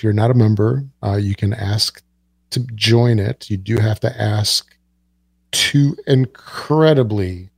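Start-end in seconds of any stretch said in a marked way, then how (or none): 5.5-7.5 drawn-out mock gravity, sarcastic. Real, not acted. Podcast host telling listeners about the join questions, sarcastic. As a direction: stretch it slowly with heavy sarcasm. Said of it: "I say that sarcastically".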